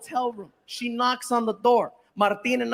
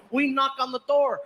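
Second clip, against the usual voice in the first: ordinary delivery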